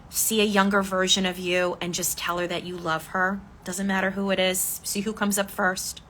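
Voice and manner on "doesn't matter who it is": ordinary talk, nothing marked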